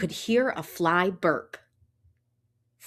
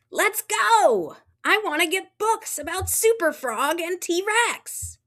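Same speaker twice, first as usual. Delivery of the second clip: high, eager little frog voice; a kid frog bouncing to go; excited Froggy; 9 semitones higher than usual. Acted. Froggy begs Mom to go to the library, high and eager.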